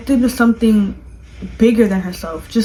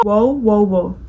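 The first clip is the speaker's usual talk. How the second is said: ordinary talk, nothing marked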